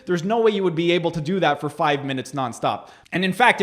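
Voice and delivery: usual speaking voice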